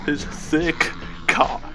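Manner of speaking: usual speaking voice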